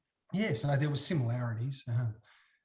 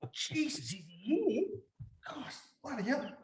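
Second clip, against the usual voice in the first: high, hushed gasp of disbelief; shocked disbelief; 9 semitones higher, 6 dB quieter than usual. Retold or imitated. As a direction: gasp it like you can't believe he's here. Retold shock at guest introduction.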